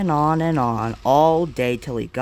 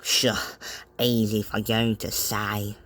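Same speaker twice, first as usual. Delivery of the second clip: softer, lower grumble to himself; grumbling; 5 semitones lower, 6 dB quieter than usual. A narrator's reading. James to himself after Thomas, grumbling. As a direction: mutter it under your breath. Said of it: "grumbled James to himself".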